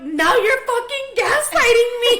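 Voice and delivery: very high, loud mock wail; hammed-up hysterical accusation; retold meltdown. Reenacting her accusation at Luis, high wailing mock outrage. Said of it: "broke down and I kept going and crying".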